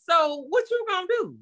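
a mother firing off incredulous questions; exasperated scolding mom. Retold or imitated. Mother scolding son on phone.

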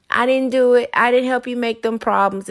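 normal talking voice

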